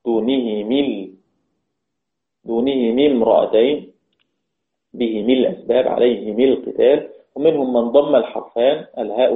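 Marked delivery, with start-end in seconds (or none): 0.0-3.9 دونه مل as "dunihimi", i after both h and m; kasra on both letters. Shown as a demonstration. Teacher shows Abu Amr's reading of دونه مل, "dunihimil" with double kasra. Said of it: "من كسر الحرفين". 4.9-7.1 به مل as "bihimil", عليه مل as "alayhimil"; kasra on both letters. Shown as a demonstration. Teacher shows Abu Amr's به مل and عليه مل, "himil" with double kasra. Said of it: "من كسر الحرفين".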